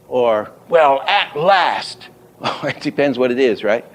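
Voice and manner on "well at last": high and slow, "at" and "last" both hit; accented reading. Shown as a demonstration. Teacher offering a last option, high slow stress on "at last". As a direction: raise the whole line, punching "at last". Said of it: "two head accents".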